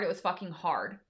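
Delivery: quiet weary echo of the line; soft heavy repeat. Shown as a demonstration.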